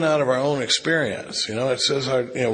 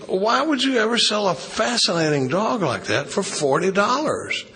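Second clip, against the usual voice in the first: mildly acted disbelief, pitched high; joke-telling astonishment, lightly hammed-up; incredulous buyer; 7 semitones higher than usual. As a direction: play a baffled customer questioning a bargain, higher voice. Incredulous buyer questions farmer in joke.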